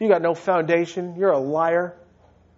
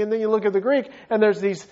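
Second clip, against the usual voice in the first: ordinary talk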